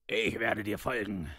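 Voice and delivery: eager dwarf. A narrator's reading. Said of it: "sagte Gimli eifrig".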